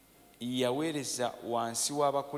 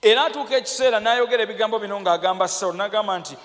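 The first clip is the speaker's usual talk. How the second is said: loud, high-pitched sermon delivery; forceful preaching; 7 semitones higher, 11 dB louder than usual. Real, not acted. Preacher quoting the prophet's words to Saul, forceful preaching. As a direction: proclaim it with full pulpit force.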